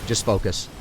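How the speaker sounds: low murmured reminder to himself; calm yourself under your breath; quiet self-talk. Real, not acted. Streamer coaching himself mid-fight, quietly.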